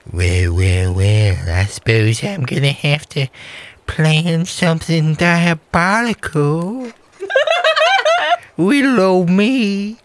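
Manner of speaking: high giggling little pig villain; high and gleeful, plotting something diabolical; squeaky villain pig voice. Acted. Villain Big Piggy speaks.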